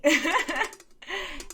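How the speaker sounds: a bright burst of real laughter; amused laugh. Real, not acted. Vlogger laughing at her plan to persuade neighbor, amused burst. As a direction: laugh at your own sneaky plan.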